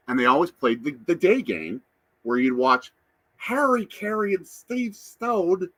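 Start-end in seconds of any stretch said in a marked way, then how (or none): 3.4-5.7 high, drawn-out bad sportscaster impersonation; slow, high-pitched mock baseball broadcaster; bad Harry Caray. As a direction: stretch the names out in a hammy announcer voice. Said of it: "My bad Harry Caray impersonation".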